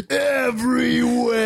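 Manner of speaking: high, stretched-out theatrical cry; drawn-out shout. Acted. Film fan quoting a punchline to co-hosts, drawn-out shout. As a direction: belt the word out, long and high.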